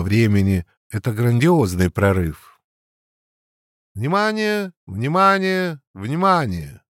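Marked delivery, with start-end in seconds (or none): 3.9-6.8 radio announcer. Acted. First radio broadcast announcement.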